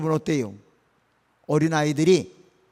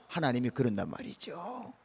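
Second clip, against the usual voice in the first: usual speaking voice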